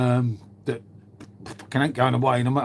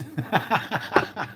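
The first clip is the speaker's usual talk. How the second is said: laugh; 4 dB quieter than usual. Real, not acted. Reacting to chat comment.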